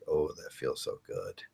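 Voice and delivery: soft, slow and contented, savouring the sun; contented sigh. Retold or imitated. Sitting in spring sunshine with coffee, soft slow contentment. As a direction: melt into the warmth, a hush of pleasure. Voicing his pleasure in the sun.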